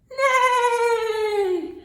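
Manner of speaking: loud, high, falling whinny; long-drawn neigh sliding down; horse neigh. Acted. Storyteller as the horse rearing up, loud high neigh. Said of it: "horse reared up and said".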